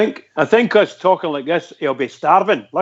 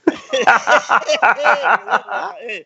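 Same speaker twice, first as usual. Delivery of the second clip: laughing at photo; 6 semitones higher than usual. Real, not acted.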